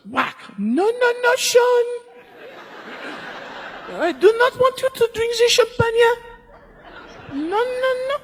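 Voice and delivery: shrill, very high mock voice of a tiny wife scolding her husband; scolding French wife. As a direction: squeak out the scolding like a bossy little wife stopping him from drinking. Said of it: "and his wife goes".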